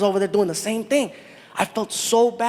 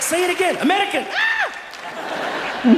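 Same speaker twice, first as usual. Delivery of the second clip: high, giddy cry up to a squeal; excited; 10 semitones higher, slower than usual. Retold or imitated. Comic begging to be called American, high and excited. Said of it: "I was excited".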